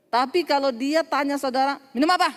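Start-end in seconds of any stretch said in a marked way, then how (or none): 1.9-2.3 imitated brusque question, louder and higher; curt offer. Bitter person grudgingly offering the preacher a drink, curt. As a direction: toss out a short, unfriendly offer.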